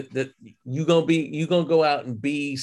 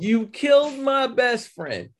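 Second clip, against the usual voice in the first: dragged-out accusing line, a touch higher; slow mock accusation; 7 semitones higher, slower than usual. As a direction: lay the blame slowly. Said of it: "on some old".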